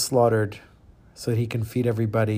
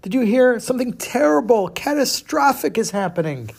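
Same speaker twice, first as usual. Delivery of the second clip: high, urgent little mouse voice; panicked mouse; 10 semitones higher, 3 dB louder than usual. Acted. Mouse warns the chicken about the trap, high and alarmed. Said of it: "And he says".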